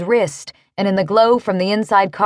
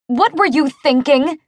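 higher-pitched indignant question; angry demand; 6 semitones higher than usual. A narrator's reading. Shaken driver to the man she nearly hit, angry demand. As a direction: demand an answer, voice raised in pitch. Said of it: "she demanded".

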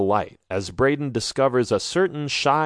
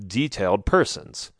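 ordinary delivery, nothing marked

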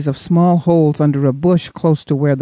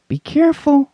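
warning; 12 semitones higher than usual. Acted. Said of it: "Nathan warned".

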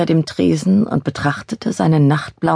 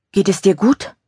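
higher, mildly anxious question; nervous; 5 semitones higher than usual. A narrator's reading. Charlotte checking on Francis by phone, mildly nervous. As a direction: ask with a touch of nerves. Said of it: "fragte Charlotte nervös".

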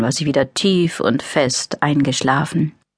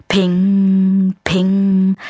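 high ringing ping sound; ping noise; 3 semitones higher than usual. Acted. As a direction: chime it like a metal ping. Same noise again. (helles Ping-Geräusch)

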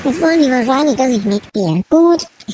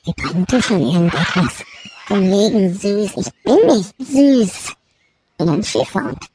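ordinary talk

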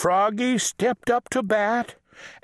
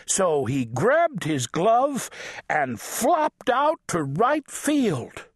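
usual speaking voice

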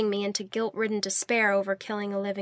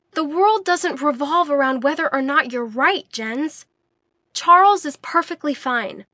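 exasperated anger; 5 semitones higher, 6 dB louder than usual. A narrator's reading. Angry exasperated rant.

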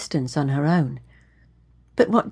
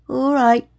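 higher-pitched, subdued giving in; sheepish; 7 semitones higher than usual. A narrator's reading. Young man told to leave her alone, sheepish. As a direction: back down meekly in a high voice. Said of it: "hanging his head sheepishly".

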